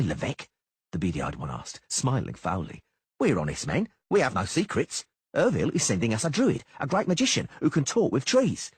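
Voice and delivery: smarmy, false friendliness; mocking mercenary. Beady-eyed man speaks.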